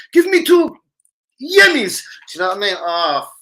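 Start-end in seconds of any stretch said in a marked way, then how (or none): 0.1-2.0 high, loud mock calls; staff calling orders. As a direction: rattle off orders like busy staff.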